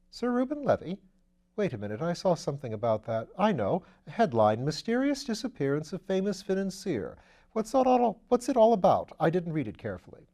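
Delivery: put-on an airy young lord teasing his valet; Lord Peter.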